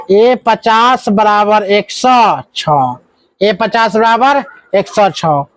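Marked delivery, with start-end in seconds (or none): 0.1-3.0 each word slowly spelled out for the class; teacher dictating the equation, drawn out slowly; slow dictation. Shown as a demonstration. Teacher dictates the equation to students, slowly and deliberately. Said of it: "इसका मतलब यह होता है".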